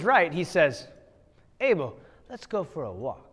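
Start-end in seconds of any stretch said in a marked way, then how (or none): 1.6-3.2 soft scheming invitation from Cain; softer hint of a scheming brother; sly Cain. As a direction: lure him out, quietly sly. Cain lures brother in story.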